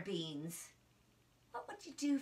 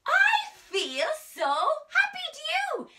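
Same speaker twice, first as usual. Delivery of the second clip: much higher, louder beaming delight; happy mask voice; 10 dB louder than usual. Acted. Drama teacher acting out the happy mask for kids. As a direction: burst out in over-the-top joy. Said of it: "a happy face mask".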